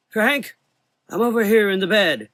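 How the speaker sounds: put-on high, loud old voice calling across the room; frail old man calling. A narrator's reading. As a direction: call him over from bed.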